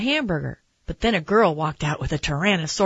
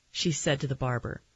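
usual speaking voice

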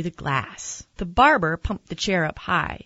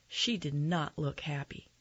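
plain reading voice

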